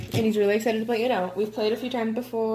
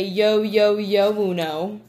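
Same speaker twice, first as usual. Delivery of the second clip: rapper voice; slower than usual. Shown as a demonstration. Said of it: "cross your arms and say".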